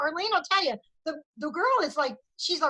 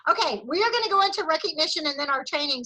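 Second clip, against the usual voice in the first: normal talking voice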